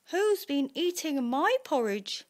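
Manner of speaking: story-time cross mother bear; Mummy Bear. A narrator's reading. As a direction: raise the pitch and demand an answer. Mummy Bear finds porridge eaten.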